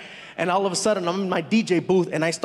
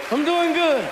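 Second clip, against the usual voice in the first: high, louder nervous reply; giddy high-pitched answer; starstruck reply; 8 semitones higher, 4 dB louder, slower than usual. Retold or imitated.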